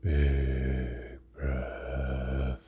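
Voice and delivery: very slow, drawn-out meditation voice; guided meditation. Real, not acted. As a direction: stretch each word with long pauses for breathing. Leads a guided breathing meditation.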